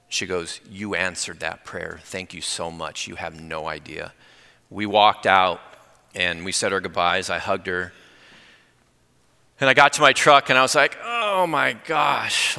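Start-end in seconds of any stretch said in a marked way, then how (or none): 0.7-4.1 slow, low, very soft thanks; hushed gratitude. Retold or imitated. Widow thanking the pastor after his prayer, hushed gratitude. 11.0-12.6 high, long-drawn exclamation falling away; overwhelmed. Retold or imitated. Pastor alone in his truck after praying, overwhelmed.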